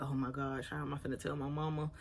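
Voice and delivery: soft, dropped-down worried murmur; hushed panic. Retold or imitated. To herself, dreading telling mom, softly worried. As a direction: fret under your breath. Freaking out inside.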